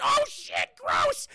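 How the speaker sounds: loud, very high mock shriek; acted horror and disgust; disgusted yell. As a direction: shriek in hammed-up revulsion. Meg sees half-melted body.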